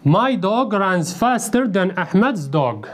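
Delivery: slow, careful model sentence. Shown as a demonstration. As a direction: read it slowly so the class can follow. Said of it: "model sentence read slowly".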